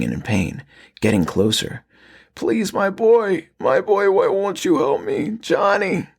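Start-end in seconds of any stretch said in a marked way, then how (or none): 2.3-6.1 much higher and slow, creature begging its son; raspy pleading. Acted. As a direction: drag out a monstrous plea for help. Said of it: "he pleaded".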